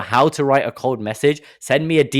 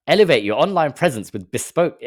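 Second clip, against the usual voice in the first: louder, higher grand marketing voice; mock grand ad read; 6 semitones higher, 4 dB louder than usual. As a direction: oversell the buzzwords. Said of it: "very funny when you just read into it".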